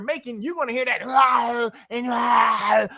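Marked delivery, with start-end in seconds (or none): 1.0-1.7 a loud scraped throat sound; mock raspy grunt. Mocking the lawyer's raspy voice with a loud rasp. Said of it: "it's that raspy". 2.1-2.9 a loud scraped throat sound; mock raspy grunt. Mocking the lawyer's raspy voice with a loud rasp. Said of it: "it's that raspy".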